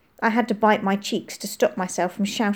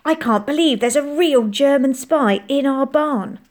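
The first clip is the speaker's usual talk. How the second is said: higher, louder thrilled disbelief; excited boyish wonder; 6 semitones higher, 5 dB louder than usual. A narrator's reading. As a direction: marvel at it with great excitement. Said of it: "His eyes shone with excitement".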